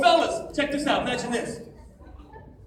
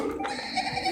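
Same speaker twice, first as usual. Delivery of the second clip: high, soft dolphin-like laugh; distant little squeal in reply; imitated answering squeal; 8 dB quieter than usual. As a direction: squeak back softly like a dolphin. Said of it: "Some little girl goes".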